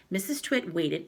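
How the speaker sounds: plain reading voice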